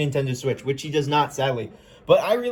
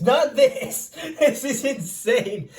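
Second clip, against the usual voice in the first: laughing; 6 semitones higher, much slower than usual. Real, not acted. Laughing at huge swords.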